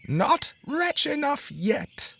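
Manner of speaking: very high, slow mocking bird voice; taunting sparrow. A narrator's reading. Sparrow mocking the unlucky carter, slow high taunt. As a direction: taunt him slowly from up high. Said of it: "said the sparrow".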